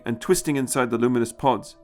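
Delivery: ordinary delivery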